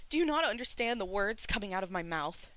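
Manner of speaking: high, loud raging voice; enraged roar. A narrator's reading. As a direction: roar at them in fury. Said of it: "Shi Qingxuan roared and raged".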